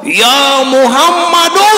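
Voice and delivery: high, drawn-out shout of the name; shouted call. Retold or imitated. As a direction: call out the name like a rude villager. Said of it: "bari ngagorowok".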